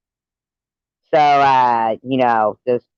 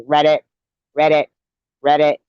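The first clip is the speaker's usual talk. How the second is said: frog croak; 3 semitones higher, 4 dB louder than usual. Retold or imitated. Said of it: "the frog goes".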